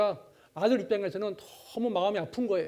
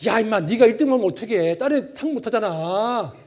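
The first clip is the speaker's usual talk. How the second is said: loud joking scold from a father; mock scolding; 10 dB louder than usual. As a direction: bark it as a fake angry dad.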